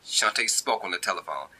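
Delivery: usual speaking voice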